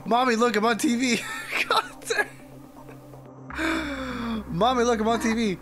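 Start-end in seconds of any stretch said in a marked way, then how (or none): none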